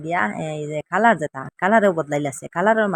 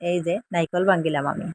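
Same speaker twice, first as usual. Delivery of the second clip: ordinary delivery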